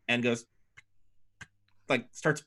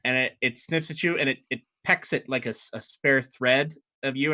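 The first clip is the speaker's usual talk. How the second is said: normal talking voice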